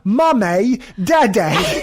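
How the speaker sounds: exaggerated Australian-Kiwi vowels, mocking; Kiwi Australian accent. Host mimicking Slater as Tiny Tim, Kiwi Australian accent. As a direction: imitate a bad Kiwi-Australian delivery. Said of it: "like a Kiwi Australian kind of".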